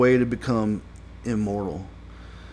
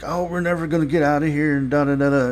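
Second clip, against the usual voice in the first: fast, higher-pitched imitation of panic; mock freak-out; 4 semitones higher, 4 dB louder, much faster than usual. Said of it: "like, freaking out".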